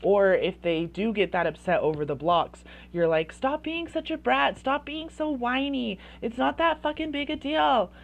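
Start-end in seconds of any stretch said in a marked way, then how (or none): 3.3-7.9 high, exasperated acted scolding; disapproving parent. Parent scolding a child upset over blocks, disapproving. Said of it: "The disapproving one is".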